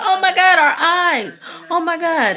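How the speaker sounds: high, loud mock shriek; shocked shriek. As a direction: yelp like you walked in on them. Harrison and Abby walk in on Huck and Quinn.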